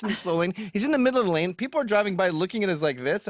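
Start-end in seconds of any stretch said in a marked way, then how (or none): none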